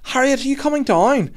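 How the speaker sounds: high, loud mock mum's call; mum shouting up. Imitating another mum at the slide, high calling shout. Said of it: "was shouting up".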